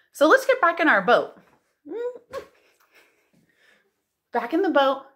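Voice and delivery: excited call. Acted. Calling kids back to the boat.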